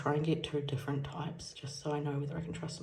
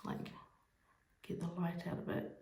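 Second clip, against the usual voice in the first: usual speaking voice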